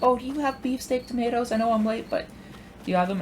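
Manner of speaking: high-pitched imitation of an old lady; sweet old lady. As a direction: put on a sweet little old lady's high voice. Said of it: "this sweet old lady".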